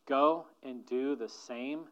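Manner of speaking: slow, deliberate charge; slowed-down repeat of Jesus' words. Retold or imitated. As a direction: lean on each word, slowly. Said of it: "Listen again to Jesus' final words".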